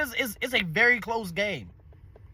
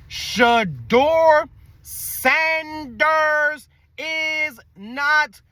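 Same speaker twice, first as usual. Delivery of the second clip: drawn out word by word, higher pitched; very slow; 4 semitones higher, much slower than usual. Shown as a demonstration. Laying it out for Browns fans, very slowly. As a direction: spell it out very slowly, stretching each word. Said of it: "I'm gonna say this very slowly".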